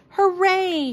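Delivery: all cheer together; shouted. A narrator's reading. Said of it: "shouted the other animals".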